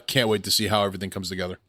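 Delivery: normal talking voice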